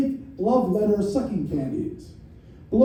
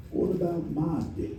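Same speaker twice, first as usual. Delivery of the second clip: slow, much softer Southern drawl; 4 semitones lower, 10 dB quieter than usual. Retold or imitated. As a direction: drawl it out like a neglected Southern caller. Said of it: "He suddenly said in a long drawl".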